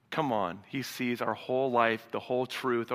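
ordinary talk

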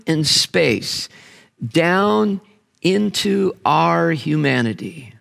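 very slow, weighty reading of the quote; slow reverent read of C S Lewis; solemn slow reading. Real, not acted. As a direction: let each phrase descend slowly. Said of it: "C S Lewis from his book Miracles".

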